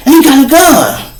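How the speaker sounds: high, strained calling out; mock hollering to the police. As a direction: holler high across the street to the cop. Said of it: "they holler at the police".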